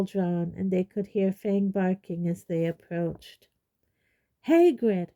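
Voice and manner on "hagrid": calls Hagrid's name out loud; loud call at the door; shouting. A narrator's reading. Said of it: "Hermione shouted".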